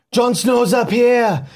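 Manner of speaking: slow, high put-on Arnold voice; hammed-up action-hero accent; mock Schwarzenegger accent. Shown as a demonstration. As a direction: drag it out like a movie muscleman. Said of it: "kind of like Arnold Schwarzenegger almost".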